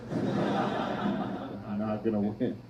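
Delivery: drawn out, slow, through laughter; laughing. Real, not acted. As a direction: laugh while repeating it very slowly.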